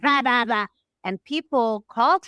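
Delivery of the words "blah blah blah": higher mock rant; imitation of someone screaming gibberish. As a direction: mimic a screamer, only half loud. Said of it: "screamed as his way of talking".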